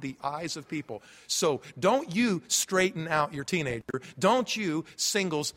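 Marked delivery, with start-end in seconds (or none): none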